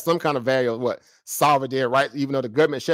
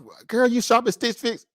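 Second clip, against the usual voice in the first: put-on higher-pitched gossipy girlfriend; imitating women chatting; 8 semitones higher than usual. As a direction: mimic a woman asking her friend, pitched up. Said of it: "you be hearing the women talk like that".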